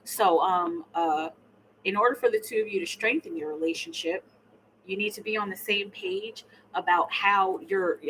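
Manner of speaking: normal talking voice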